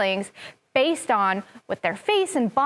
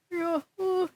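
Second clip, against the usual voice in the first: worried; 6 semitones higher, 5 dB quieter than usual. Shown as a demonstration. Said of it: "worried about something".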